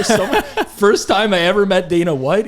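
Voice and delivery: tickled, voice lifted by laughter; amused. Real, not acted. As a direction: launch the anecdote through a real laugh. Laughing at own funny anecdote.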